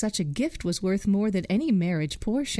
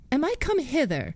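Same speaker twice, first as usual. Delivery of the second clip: proud and saucy; 7 semitones higher than usual. Acted. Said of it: "said the proud, saucy one".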